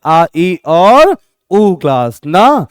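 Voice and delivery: very slow, each vowel stretched; emphatic slow listing; slow drawn-out vowels. Shown as a demonstration. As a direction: spell out आ, ई, उ one by one, lingering. Teacher chants key vowels.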